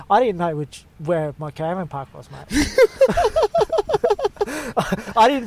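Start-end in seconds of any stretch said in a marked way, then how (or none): 2.5-5.1 helpless squealing laugh at yourself; big high laugh. Real, not acted. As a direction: burst of high-pitched laughter. Laughing at being lost.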